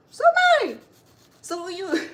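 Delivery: sudden high, loud outburst; indignant retort. Retold or imitated. To her friend who pities the store owner, a loud indignant retort. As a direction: fire it back in mock outrage, then ease off.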